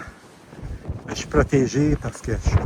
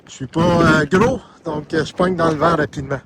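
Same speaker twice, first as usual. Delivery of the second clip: shouting over wind; 4 semitones higher, 5 dB louder than usual. Real, not acted. Filming in strong summit wind.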